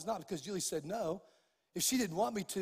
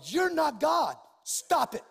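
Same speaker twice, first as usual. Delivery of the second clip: loud, higher re-enacted scolding; stern rebuke; 6 semitones higher, 7 dB louder, slower than usual. Preacher rebuking a doctor, retold stern rebuke. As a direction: lay down the law forcefully. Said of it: "And I just told them".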